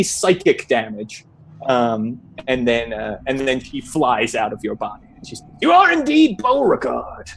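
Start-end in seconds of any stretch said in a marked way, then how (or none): 5.6-7.3 high-pitched grand pronouncement; proclaiming examiner. Acted. Examiner leaving Beauregard's body, confirming identity, proclaiming. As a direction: declare it high and ceremonious.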